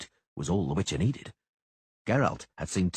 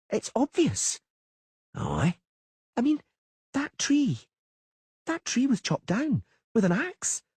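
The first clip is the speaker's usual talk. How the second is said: put-on much higher young girl; girl's voice; 12 semitones higher than usual. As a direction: clever little girl, nervous. Ciri's voice.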